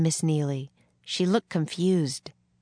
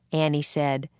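ordinary delivery

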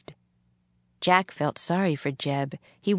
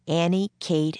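ordinary delivery, nothing marked

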